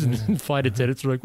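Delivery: ordinary talk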